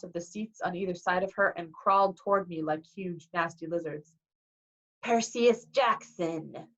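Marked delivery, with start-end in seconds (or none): 5.0-6.7 slow Southern menace; deep Southern accent. A narrator's reading. Fury teacher confronting her pupil, slow Southern accent. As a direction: draw the name out in a Southern accent. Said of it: "said in an accent that was definitely".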